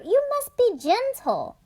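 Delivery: high, bossy big-sister voice; big sister warning about the puppy, pitched high. Acted.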